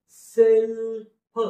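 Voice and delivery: Self very slow, फ split off; drawn-out teaching sound-out of self; slow, broken-up pronunciation. Shown as a demonstration. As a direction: stretch self loudly into separate parts. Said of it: "समजण्यासाठी मी हळूहळू करतोय".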